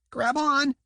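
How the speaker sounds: high squeaky voice, muffled underwater; high squeaky dolphin under water. A narrator's reading. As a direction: squeak it like a small dolphin calling. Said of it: "his squeaky voice muffled by the water".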